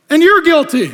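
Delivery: loud, high twist of the sentence; spring the surprise guilty on them; loud verdict. Acted. Jesus turning on the cheering side, loud and high.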